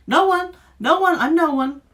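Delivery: high, loud, desperate wail; terrified cry. A narrator's reading. Duke's son wailing in terror beneath the witch. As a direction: shriek it out, near tears. Terrified prince, then cries.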